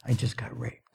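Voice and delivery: imitating a whispered secret; retold whisper. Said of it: "she whispered in my ear".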